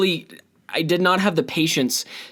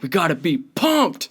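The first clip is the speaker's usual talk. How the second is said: hype voice; 3 semitones higher than usual. Retold or imitated. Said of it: "that's just what I was thinking when I was like".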